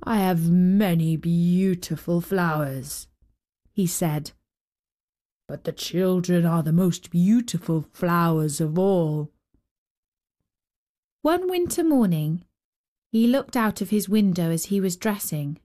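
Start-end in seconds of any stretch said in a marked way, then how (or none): 0.0-9.3 put-on old giant voice. Giant speaks in story.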